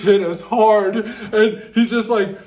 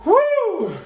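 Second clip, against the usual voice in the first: high cheer; high celebratory woo; excited whoop; 13 semitones higher than usual. Real, not acted. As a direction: whoop with delight.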